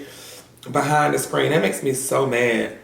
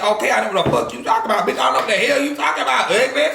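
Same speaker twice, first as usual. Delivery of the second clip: fast, rising, higher-pitched comeback, climbing to a sharp peak; heated retort; 8 semitones higher, faster than usual. Retold or imitated. Answering a rude commenter with a fast, high, heated retort. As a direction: snap back at her faster and higher with every line. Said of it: "I would've said".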